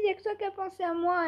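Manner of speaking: high and quick, moved; grateful neighbour. Retold or imitated. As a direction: rush it out, high and touched.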